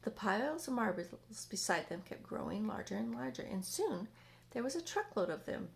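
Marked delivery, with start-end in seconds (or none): none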